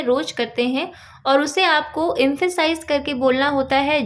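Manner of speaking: ordinary talk, nothing marked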